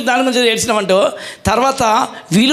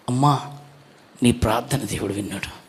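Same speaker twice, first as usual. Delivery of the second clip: low, soft hint of a kindly priest; scene: priest Eli blessing the weeping Hannah, a low soft hint; lightly voiced priest Eli; 11 semitones lower, 7 dB quieter than usual. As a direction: gently tell her, in a lower, softer voice, that her prayer was heard.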